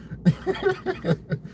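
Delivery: high, breathy put-on giggle; imitated Trump laugh. Commentator retrying the president's laugh, high put-on snicker. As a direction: snicker in rapid bursts as a president. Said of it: "I don't know how to do a Trump accent".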